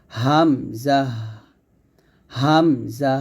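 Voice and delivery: হামজা started in the throat, long syllables; Hamza from the throat. Shown as a demonstration. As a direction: bring হামজা out slowly from the throat. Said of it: "এরকমভাবে উচ্চারণটা হবে".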